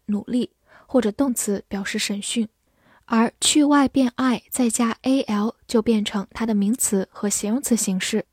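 usual speaking voice

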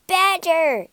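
high, loud call; urgent yell. A narrator's reading. Rat calls to Badger from outside the door, yelling. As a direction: yell it through a shut door. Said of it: "yelled Rat".

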